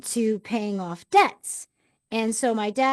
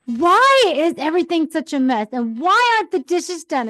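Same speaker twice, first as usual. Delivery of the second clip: louder, higher scolding mom; imitating a frustrated mom, loud and raised; mother's exasperated scolding; 6 semitones higher, 6 dB louder than usual. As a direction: demand why the house is a mess. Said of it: "She's just like".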